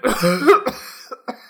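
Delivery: loud, high hacking cough from fumes; choking cough. Acted. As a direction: cough as if poisoned.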